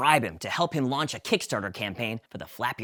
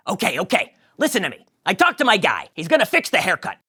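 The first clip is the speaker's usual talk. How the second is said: louder Jerry; 6 semitones higher, 7 dB louder, faster than usual. Acted. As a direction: reassure with news. Jerry speaks.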